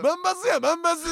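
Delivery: high, loud hyped shout; big loud voice. Retold or imitated. Imitating a colleague loudly celebrating a viral post backstage, shouting. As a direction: yell it out like a viral hit. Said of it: "ずっとでかい声で".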